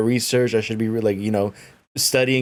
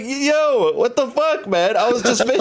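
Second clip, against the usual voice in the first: pitched way up, indignant mock yell; high, disbelieving rant at a clumsy friend; outraged yelling; 10 semitones higher than usual. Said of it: "I was like".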